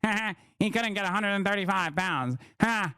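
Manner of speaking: lightly put-on gloating nerd with fake laughs; mock a smug nerd bragging about strength; mocking nerd loser. Streamer mimicking a boastful nerd, mocking. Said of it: "you're over here being like".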